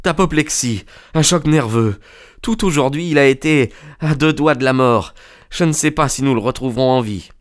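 put-on high, strained young man's voice; distressed young friend. A narrator's reading. Son telling his friend the father is dying, high and distressed. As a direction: blurt out grave news, high-pitched.

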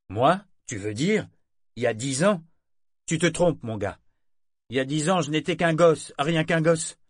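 voix plus haute et forte, faussement surprise; Brachen qui dément. A narrator's reading. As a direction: nie avec aplomb. Brachen feint de nier. (Brachen qui corrige le matelot d'un air faussement étonné)

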